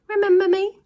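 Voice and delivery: put-on high, eager boy voice, popping up; a cheeky kid catching the crooks out; boy's indignant voice. Ned hovers outside the van and challenges the ice cream thieves in a high boy voice.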